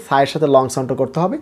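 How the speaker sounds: ordinary delivery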